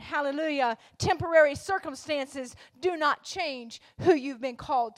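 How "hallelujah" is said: usual speaking voice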